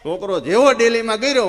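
ordinary talk, nothing marked

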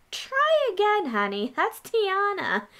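high, sassy, slow "honey" put-down; sassy correction. Retold or imitated. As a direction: correct her playfully, drawn out. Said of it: "I was like".

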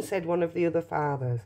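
ordinary delivery

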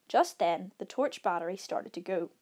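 ordinary delivery, nothing marked

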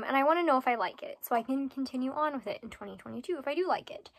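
usual speaking voice

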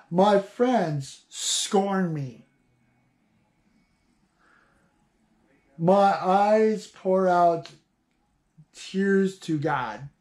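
slow, grave reading of the lament; slow scripture reading. Acted. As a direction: read it slowly, letting each phrase sink. Reads Job's lament slowly.

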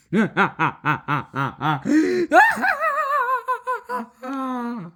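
long laugh climbing into a high wail; exaggerated cackle. Play character after the fainting scene, exaggerated cackle.

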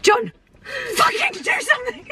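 high-pitched mock panic, cracking into laughter; mock panicked plea. [name] retelling waking her husband on the plane, high mock panic. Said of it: "I went, I went".

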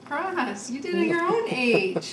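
bright, loud praise; excited praise. Real, not acted. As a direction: burst into praise. Mother cheering success.

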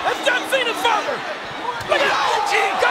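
high-pitched, horrified exclamation; shocked alarm. Real, not acted. Commentator recognising the wrestler's father, shocked and alarmed. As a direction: cry out in disbelief at what is happening.

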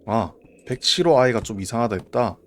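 ordinary talk, nothing marked

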